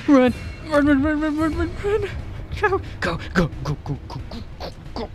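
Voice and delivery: frantic high yelling; being chased. Real, not acted. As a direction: shout run while fleeing. Fleeing monster, yelling run.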